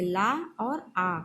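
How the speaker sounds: ला और आ, each syllable drawn out very slowly; ला और आ बहुत धीरे, अलग-अलग; very slow separated syllables. Shown as a demonstration. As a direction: spell out ला and आ slowly as separate sounds. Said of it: "actually हम क्या बोलना चाहते हैं".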